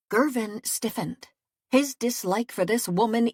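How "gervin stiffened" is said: plain reading voice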